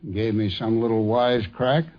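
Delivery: usual speaking voice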